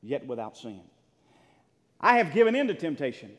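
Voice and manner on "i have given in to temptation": loud, high preaching burst; full-force preaching. Acted. Pastor to his church, loud preaching. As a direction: preach it out to the congregation.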